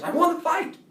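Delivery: high, loud victory claim; imitated insistent yell. Bully answering the baffled kid, high insistent yell. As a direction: insist loudly that you are the winner. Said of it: "he keeps yelling".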